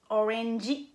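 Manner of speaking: orangey in separate beats, "o-ren-ji", ending on a clear ee; I final ajouté. Shown as a demonstration. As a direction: add a Japanese-style "ji" to the end of orangey. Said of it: "ils le déforment en mettant un I". (orangey à la japonaise, « o-rènn-dji », avec un i final)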